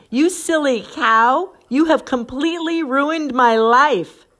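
loud, high, slow mock fury; hammed-up exasperated rant; acted angry outburst. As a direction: hurl it like a melodramatic accusation.

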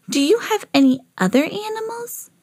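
high sweet doll voice. A narrator's reading. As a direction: voice Bitty Baby high and small. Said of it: "asked Bitty Baby".

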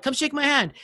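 high-pitched needling demand, falling at the end; mock insistent goading. Winner pestering the loser for a handshake, goading. Teller goads angry opponent.

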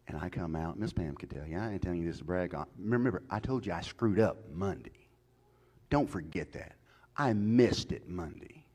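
ordinary talk

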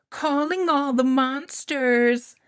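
high, loud, slow title; dramatic announcement. Acted. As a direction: announce it grandly.

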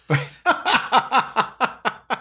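high, breathy laugh; burst of laughter. Real, not acted. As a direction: laugh out at the memory. Laughing at his own story.

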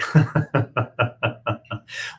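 loud high burst of laughter. Real, not acted. Streamer bursts out laughing at a viewer's joke, loud and high. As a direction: crack up at the comment.

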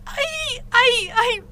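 sharp, very high cry of pain; girl crying out from a pounding headache; pained cries. Acted. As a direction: yelp as the headache hits. Sudden head pain.

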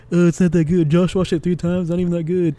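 lightly put-on dismissive voice; mock unimpressed reaction. Imitating co-host's reaction to the fourth watch, dismissive. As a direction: imitate a friend shrugging the film off. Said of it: "your reaction to it".

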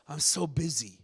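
low, deflated complaint; mock weary reply. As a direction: play the overworked person answering a greeting. Acted weary reply to greeting.

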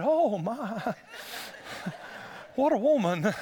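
amused gasp, laughing; laughing admiration. Retold or imitated. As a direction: say it half-laughing with delight. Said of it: "that same woman said".

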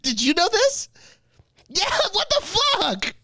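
very high, incredulous squeal; astonished outrage. Real, not acted. Podcaster turning to others over fake TV, astonished. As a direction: shoot the pitch way up in disbelief. Listener reacts to staged court news.

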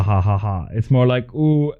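ordinary delivery

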